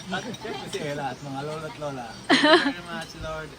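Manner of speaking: soft spoken prayer. Real, not acted. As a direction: pray quietly and gently. Birthday prayer for Ella.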